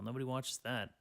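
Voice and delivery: ordinary talk, nothing marked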